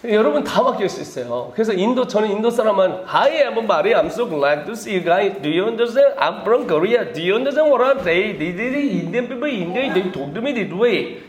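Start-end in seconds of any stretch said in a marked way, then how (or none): 3.1-8.4 slow, drawn-out mock Indian English; lightly imitating an Indian speaker's English; Indian accent imitation. 강사가 학생들에게 인도 영어 흉내, slow mock Indian accent. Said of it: "이렇게 얘기합니다". 8.4-11.2 lilting mock Indian English, very slow at the end; playful take-off of an Indian speaker; Indian accent imitation. Lecturer mimics how Indians talk to him, mock Indian accent. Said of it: "이렇게 얘기합니다".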